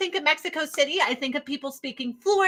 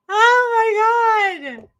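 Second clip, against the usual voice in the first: laughing disbelief; 9 semitones higher, 6 dB louder, much slower than usual. Real, not acted.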